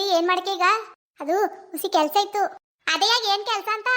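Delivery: mother-in-law scolding the daughter-in-law for not washing the dishes. Acted.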